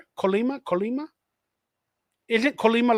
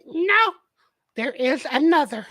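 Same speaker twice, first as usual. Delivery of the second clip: higher-pitched line in a light character imitation; Yoda; 4 semitones higher than usual. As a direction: quote the wise little Jedi in a put-on voice. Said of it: "As Yoda would say".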